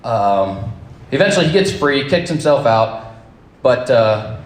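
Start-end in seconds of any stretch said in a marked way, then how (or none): none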